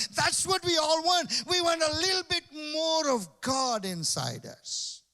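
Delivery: loud, high preaching voice, pushing hard; fiery sermon voice; full-force preaching. Acted. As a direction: preach it out to the crowd.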